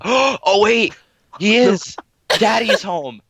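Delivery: high gasping fake surprise; mock shock. Reading the fanfic aloud in hammed-up shock. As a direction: gasp it like a scandalized fan.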